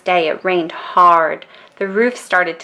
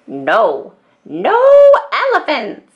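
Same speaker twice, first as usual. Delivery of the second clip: "no" rising into a high "elephants"; a mild storybook shout of refusal; 7 semitones higher, 6 dB louder than usual. A narrator's reading. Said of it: "shouted Grandma".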